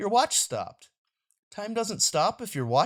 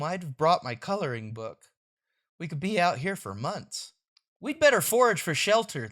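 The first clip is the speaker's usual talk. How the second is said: plain reading voice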